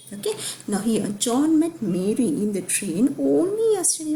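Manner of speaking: teacher pointing out only yesterday; stress on only yesterday. Shown as a demonstration. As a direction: lean on the word yesterday. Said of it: "only is qualifying yesterday".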